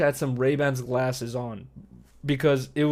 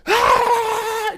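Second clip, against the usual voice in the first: high, loud mock scream; imitated scream; 6 dB louder than usual. Streamer acting out the angry neighbor at his door, screaming. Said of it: "Just fucking screaming".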